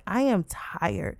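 usual speaking voice

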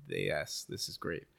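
low, hushed mock satisfaction; quiet retold approval. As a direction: say it softly, as if to yourself. Retold reaction to silent character.

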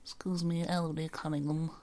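high little rat voice, begging politely; a tiny polite rat asks for a treat; squeaky rat voice. Pretend.

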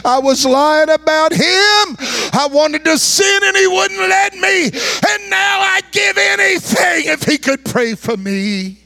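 high, slow cry building to a shout; anguished confession. Retold or imitated. Dying father confesses to his sons.

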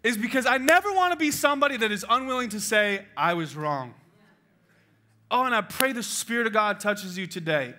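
high, loud preacher's call; full-force preaching. Acted. Pastor exhorting the men in the room, loud and high. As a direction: drive it home to the congregation.

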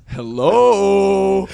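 high, loud drawn-out hello; cop calling out. Retold or imitated. Cop calls to the people at the wall, loud drawn-out hail. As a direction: call out loudly, stretching the word. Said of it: "So then the cop goes".

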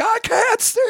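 very high, strained mock panic cry; Jeff Goldblum panicking. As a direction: squeal it like a blinded man freaking out. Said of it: "Jeff Goldblum's like".

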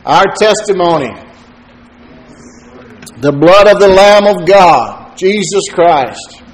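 proclaiming from the pulpit, loud; preaching. Acted. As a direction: preach it out with full force. Preacher opening the sermon.

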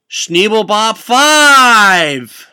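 loud, rising, drawn-out name announcement; ring-announcer introduction; big announcer intro. Acted. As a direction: stretch the last word into a huge shout. Introduces the musical act.

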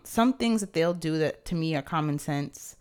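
usual speaking voice

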